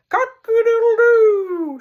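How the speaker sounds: high, loud, slow crowing; rooster crow. Acted. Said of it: "shouted the rooster".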